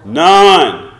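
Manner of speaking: loud answer. Real, not acted. Answering the preacher's question, loud. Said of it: "Say that loudly".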